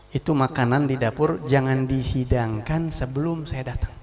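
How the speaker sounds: normal talking voice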